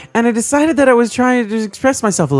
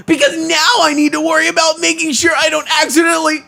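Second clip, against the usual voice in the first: flustered anime hero; 8 semitones higher than usual. Acted. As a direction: cry it out like a flustered anime lead. Flustered anime protagonist redo.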